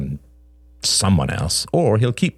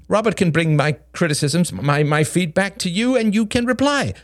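high, flustered man's voice; protesting Greg; 13 semitones higher than usual. A narrator's reading. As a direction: protest in a high voice, climbing towards the end.

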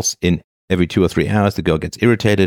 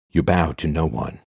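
epic hero line; 4 dB quieter, slower than usual. Acted.

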